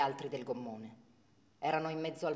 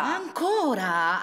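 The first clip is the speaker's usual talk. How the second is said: exasperated; 8 semitones higher, 9 dB louder, much slower than usual. Acted. Parent exasperated at repeat answer.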